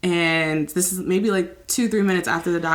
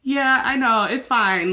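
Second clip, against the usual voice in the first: lightly voiced mom, higher and breezy; mom's easy reply; 8 semitones higher than usual. Mother shrugging off her daughter's apology, higher and casual. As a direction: brush it off like a patient mother. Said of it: "And she goes".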